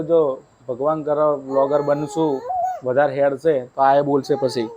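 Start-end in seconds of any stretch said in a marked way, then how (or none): none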